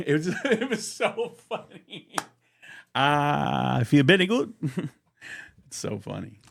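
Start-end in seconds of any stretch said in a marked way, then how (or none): 2.9-4.5 a player proudly answering in broken English; Dominican player accent. Retold or imitated. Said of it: "the guy from the Dominican".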